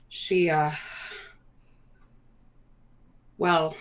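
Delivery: soft, halting, sinking in pitch; mayor breaking bad news. Mayor tells visitors about their sick friend, softly hesitant.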